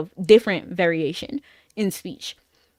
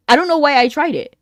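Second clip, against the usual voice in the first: louder, exasperated rerun of the line; louder repeat; 4 semitones higher, 6 dB louder than usual. Real, not acted. Streamer laughing at her own bad guess, louder. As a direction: say it again with more push, a bit exasperated.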